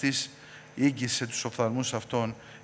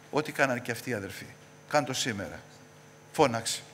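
normal talking voice

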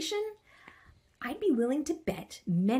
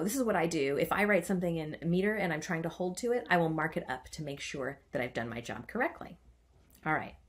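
usual speaking voice

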